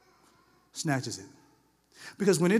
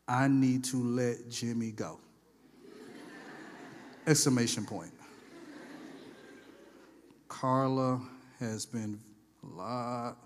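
note-taking voice; 8 semitones lower, 7 dB quieter than usual. Acted. Dictating notes to self.

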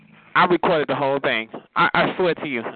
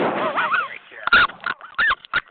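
very high cackle in bursts; helpless laugh. Real, not acted. Taunting a rival caller, cracking up.

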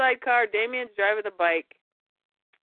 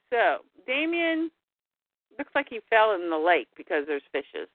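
ordinary delivery

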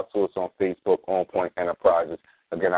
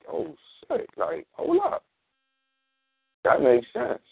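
mock surprise; 10 semitones higher, slower than usual. Mimicking people's sudden realization.

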